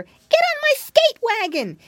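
very high, loud, drawn-out call; high, stretched piggy boy holler. A narrator's reading. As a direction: call urgently to the lady cat. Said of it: "he called to the old lady cat".

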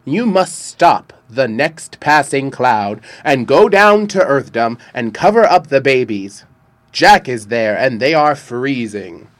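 loud commanding king; a winter king calling his court; booming king voice. A narrator's reading.

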